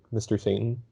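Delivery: normal talking voice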